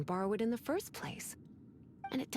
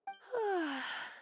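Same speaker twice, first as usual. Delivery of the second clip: a much higher sigh that falls away; long falling sigh, pitched up; wistful sigh; 10 semitones higher than usual. Acted. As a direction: sigh as if the plans can wait. Lisa after the outing plans.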